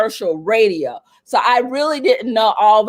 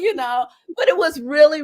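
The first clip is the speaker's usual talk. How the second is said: ordinary talk, nothing marked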